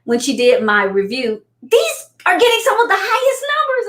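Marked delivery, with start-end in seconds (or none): 1.6-3.9 much higher, thrilled news; excited report. Retold or imitated. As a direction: burst it out like great news. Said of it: "And she said".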